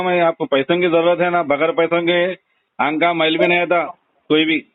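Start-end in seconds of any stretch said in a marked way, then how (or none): none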